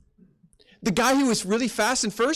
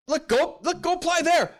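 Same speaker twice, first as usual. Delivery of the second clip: urging; 8 semitones higher than usual. Retold or imitated. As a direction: push the kid to go apply. Urging a kid to apply.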